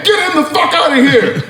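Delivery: high laughing shout; gloating shout. Retold or imitated. Player gloating at rival after winning shot, shouting. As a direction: crow over the beaten team.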